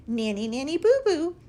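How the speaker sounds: high, loud singsong taunt; mocking taunt. A narrator's reading. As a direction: sing it out like a playground tease. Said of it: "mocked the wobbliest egg".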